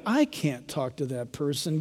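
slow, low, hushed mock protest; reluctant excuse. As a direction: back away under the voice. Voices reluctant believer answering God.

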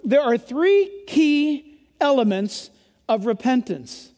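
ordinary delivery

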